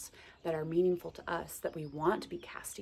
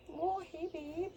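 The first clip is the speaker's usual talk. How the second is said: much higher, playful buzzing-bee flirt; flirty bumblebee voice; 11 semitones higher than usual. Retold or imitated. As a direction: greet like a cheeky little bee calling you babe. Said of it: "and he's so, like".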